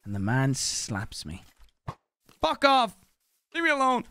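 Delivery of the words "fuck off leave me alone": angry yell. Real, not acted. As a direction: yell at the attacker to back off. Slapped by another player.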